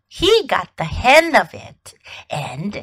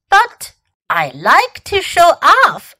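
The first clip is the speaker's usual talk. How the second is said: high, slow, louder protest; 6 semitones higher, 5 dB louder than usual. A narrator's reading. Fox objecting to teacher's order, protesting. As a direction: plead your case, pitched up.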